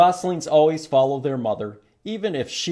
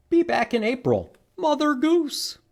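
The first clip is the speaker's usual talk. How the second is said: lightly voiced goose; 11 semitones higher than usual. Note left by Goose.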